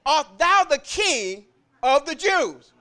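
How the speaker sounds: slow, weighty reading of "thou"; slow drawn-out question. Acted. Reading the question to the congregation, slow and weighty. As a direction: lean into "thou" and take your time. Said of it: "He said".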